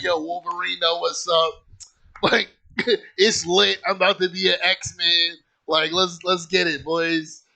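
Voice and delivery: put-on rally the boys, buzzing and laughing; hyped-up excitement. Five-year-old thinking Wolverine is visiting, hyped-up and excited.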